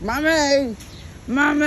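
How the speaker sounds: high, loud imitation of whining kids; kids calling mom. Imitating her kids yelling at her, high and whiny. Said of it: "they're like".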